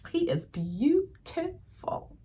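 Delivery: high, slow, soft swoon over a handsome actor; dreamy swoon, drawn out and hushed. Real, not acted. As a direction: melt as you sigh out the words.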